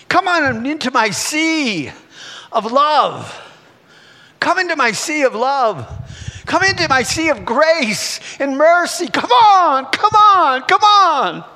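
high preaching call, building to a shout; urgent exultant summons. Acted. Preacher voicing Jesus calling Peter onto the sea, building to shouts. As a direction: call them out, climbing to shouted repeats.

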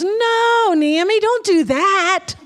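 put-on high, loud child's plea; pleading little boy. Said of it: "And Oakland goes".

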